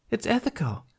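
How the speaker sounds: high, bright matter-of-fact; as if it's obvious; Tooth's chirpy voice. A narrator's reading.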